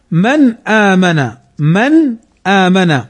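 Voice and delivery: slow من آمن, clear n then long aa; clear izhar. Shown as a demonstration. Teacher quoting the verse, slow clear noon in من آمن. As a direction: recite من آمن word by word, n unmerged. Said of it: "فنظهرها هنا".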